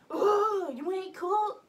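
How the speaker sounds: high, loud blurted question with a cry in it; accidental rude outburst. Retold or imitated. High daughter blurting rudely at her mom, loud and high. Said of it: "I didn't mean to say it like that".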